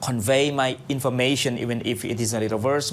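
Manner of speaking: measured, solemn opening recitation; formal invocation. Acted. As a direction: recite the blessing steadily. Opens radio program with Islamic invocation.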